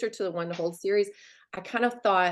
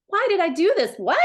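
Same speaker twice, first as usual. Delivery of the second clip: mock bewildered; 11 semitones higher, 5 dB louder than usual. Husband's confused reply, quoted.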